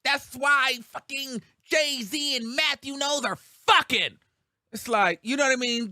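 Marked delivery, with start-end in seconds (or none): 0.0-4.2 higher, louder scandalized mock rant; 1 mock gossip rant. A vlogger imitating Tasha K's rumours, loud mock outrage. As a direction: spit out the rumour like a trashy host. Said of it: "she would give that".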